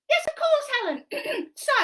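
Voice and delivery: high, loud cheeky monkey voice; squeaky puppet monkey helping Helen. Acted. Monkey puppet explaining a game to Helen, high and bouncy.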